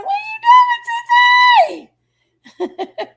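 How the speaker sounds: very high, slow, drawn-out singing hello; sing-song greeting. Real, not acted. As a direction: croon the question like a playful tune. Greeting a viewer in live chat.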